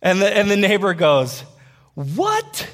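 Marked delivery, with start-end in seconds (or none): 1.9-2.7 very high, squeaky rising question; mock astonishment, pitch shooting right up; shocked disbelief. Neighbor learning they are a church, shocked.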